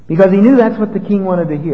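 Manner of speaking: ordinary talk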